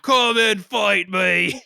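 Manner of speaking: slow, drawn-out taunt; mock challenge. [name] at his chalk octagon, slow taunting challenge. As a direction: call them out slowly. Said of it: "He's just".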